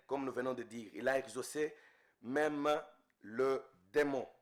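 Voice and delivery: ordinary talk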